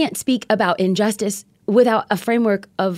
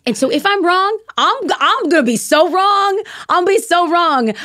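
emphatic; 9 semitones higher, 3 dB louder than usual. Real, not acted. Emphatic conviction, raised voice.

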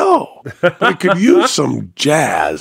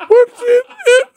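high cracked squeak, louder; squeaky broken voice; 4 dB louder than usual. Retold or imitated. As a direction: squeak it out like a voice giving way. Imitating his broken voice.

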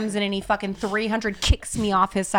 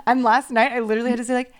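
ordinary talk, nothing marked

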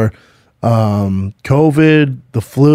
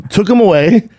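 ordinary talk, nothing marked

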